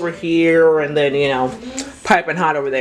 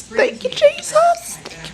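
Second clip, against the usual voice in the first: very high, drawn-out thanks; jubilant squeal; slower than usual. Real, not acted. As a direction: squeal your thanks with joy. Relief that the food worked.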